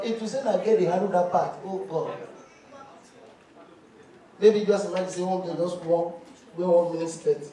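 normal talking voice

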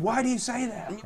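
high-pitched, taken-aback question; mock alarm. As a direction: imitate the rattled officer, voice jumping up. Retold policeman questioning the threat.

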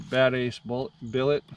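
ordinary talk

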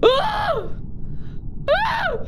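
sudden high shriek; scared shriek. Real, not acted. Reacting to the chocolate eggs, high shriek. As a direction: shriek in mock fright.

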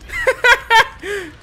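high loud laughter; cackling high laugh. Real, not acted. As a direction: laugh at the fooled killer. Laughs at fooled killer.

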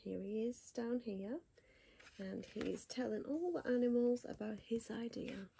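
ordinary delivery

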